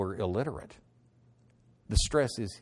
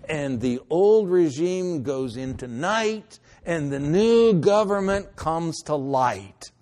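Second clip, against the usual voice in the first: preaching loud; 5 semitones higher, 9 dB louder than usual. Real, not acted. Preacher building to climax.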